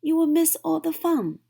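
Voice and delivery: high, loud and fast plea; crying out. A narrator's reading. Frog pleading with Toad not to sleep, fast and high. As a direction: urge him quickly. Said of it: "cried Frog".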